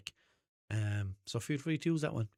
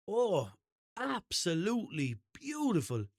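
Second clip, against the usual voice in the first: higher, slow gushing; gushing praise; 8 semitones higher, slower than usual. Retold or imitated. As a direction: rave about the taste. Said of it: "she wanted to emphasize how nice".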